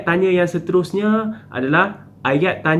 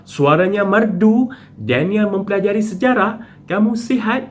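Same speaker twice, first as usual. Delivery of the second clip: "suaranya merdu", "Daniel mempelajari sejarah", "kamu sihat" each pitched high as questions; questioning intonation; 6 semitones higher than usual. Shown as a demonstration. Teacher demonstrating spoken questions to pupils, questioning intonation on "merdu", "sejarah", "sihat". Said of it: "kamu menggunakan intonasi ayat bertanya".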